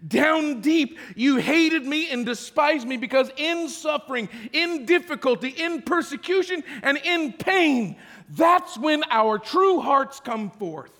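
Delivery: loud, high preaching, slowing on pain; full force preaching. Acted. Preacher hammering the point to the congregation, loud. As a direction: drive it home from the pulpit.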